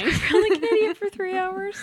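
high, teasing repeat; mocking echo. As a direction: parrot it back playfully. Echoing friend's complaint mockingly.

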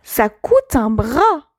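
acted "what?!" then slow, drawn-out disbelief; mock outrage, slowing right down; incredulous. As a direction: play stunned by the cost, stretching the words. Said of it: "Avec un ton un peu".

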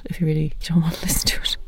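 fast teasing whisper; quick joking whisper to the listeners; playful whisper. Pretend. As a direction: rush it out as a cheeky whisper.

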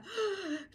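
sharp mortified intake of breath; sudden mock-shocked gasp; imitated gasp. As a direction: gasp as if horribly embarrassed. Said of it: "She's like".

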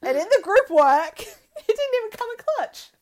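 high, loud laughing brag. Real, not acted. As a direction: crack up while bragging.

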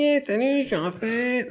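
very high, squeaky-pitched mock character voice; imitation de Raphaël. Podcaster mimicking a film character introducing himself, high mock voice. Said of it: "Il fait".

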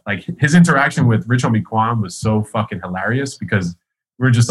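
ordinary talk

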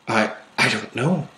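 halting, subdued stammer; forcing words out. A narrator's reading. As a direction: push the words out with effort, softly. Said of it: "trying to force the words out".

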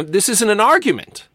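high-pitched comic objection; hammed-up complaint from a sketch customer; mock exasperated protest. Acting exasperated man in sketch.

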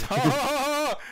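high, loud imitation of Seth Rogen's laugh; hammed-up high cackling laugh. Mocking Donkey Kong's laugh, high and loud. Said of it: "he even does the laugh".

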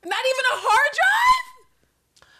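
can't believe the plot skipped it; incredulous. Real, not acted. Host baffled that nobody took the hard drive.

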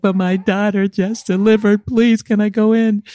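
high-pitched plaintive begging; retold desperate pleading. Grandmother begging hospital staff for entry, retold pleading. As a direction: beg to be let in, acting it out.

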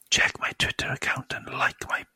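whispering sweet nothings. Pretend. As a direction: breathe it softly into her ear. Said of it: "it'd probably go like this".

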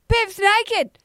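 high-pitched imitation of startled children; kids' exclamation. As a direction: pipe up like a scandalised kid. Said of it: "my kids, and they saw it".

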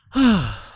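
loud weary sigh; exasperated sigh. Real, not acted. Tired of the show's characters, heavy exasperated sigh. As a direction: heave a big fed-up sigh.

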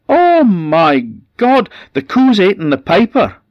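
shrieking in horror, high and loud; wife screaming in terror at the byre; horrified scream. A narrator's reading. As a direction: scream it out like she's found a corpse. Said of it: "and then she screamed".

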